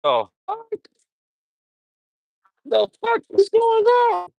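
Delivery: high, rising "the fuck is going on"; shocked disbelief. Retold or imitated. Streamer reliving shock at a wrestling result, pitched high. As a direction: sound stunned and outraged. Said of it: "'cause I'm like".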